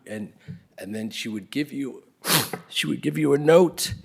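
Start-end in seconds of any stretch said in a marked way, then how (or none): none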